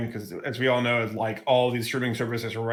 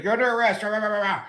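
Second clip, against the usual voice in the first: barking cop; 7 semitones higher, faster than usual. Retold or imitated. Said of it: "And he's like".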